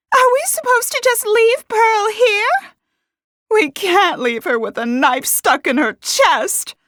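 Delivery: very high, loud outraged protest; frantic shouting. A narrator's reading. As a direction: protest at the top of the voice. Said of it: "one of the women shouted".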